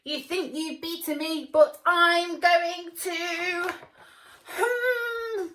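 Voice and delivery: high loud villain, slowing to a whine; threaten, then get cut off; high loud sing-song threat. Acted. Villain trapped.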